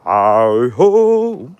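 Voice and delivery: a real gasping "oh-ho" while nearly falling; startled yelp. Real, not acted. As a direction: caught off balance, yelping. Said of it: "shit, I nearly fell then".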